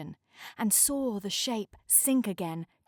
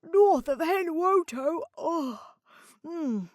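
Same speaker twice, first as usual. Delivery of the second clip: sleepy yawn; 8 semitones higher, 3 dB louder, slower than usual. Acted. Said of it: "with a sleepy yawn".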